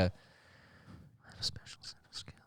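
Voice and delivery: whispered, very soft menace-film impression; Liam Neeson. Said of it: "if he said that to the".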